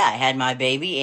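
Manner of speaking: usual speaking voice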